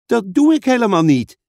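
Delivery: loud, high denial; indignant call. Acted. Suze denying that she is pulling Pieter, calling out indignantly. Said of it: "riep Suze".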